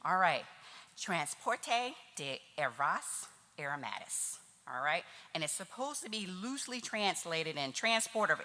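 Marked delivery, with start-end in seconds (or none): 1.0-4.4 Transporte de Ervas Aromatis, said slowly in careful Portuguese. Shown as a demonstration. Speaker reading a Portuguese label to the audience, careful. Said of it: "I gotta make sure I say this right".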